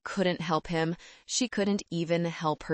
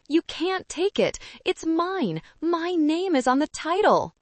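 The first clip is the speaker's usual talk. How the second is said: high, pleading whine; desperate whining man; 10 semitones higher than usual. A narrator's reading. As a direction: beg like a spoiled child. Kevin clinging to the car.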